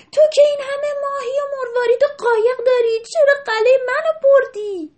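put-on high furious child's shout at the sea; angry shout. A narrator's reading. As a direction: yell at the sea for taking the castle. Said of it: "سر دریا شروع کرد داد کشیدن". (فریاد عصبانی دخترک سر دریا)

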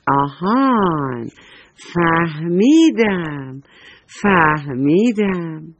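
drawn out, very slow aha; slow dawning eureka. Acted. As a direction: stretch every word as the idea lands. Blue pencil gets an idea.

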